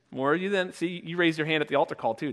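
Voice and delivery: ordinary talk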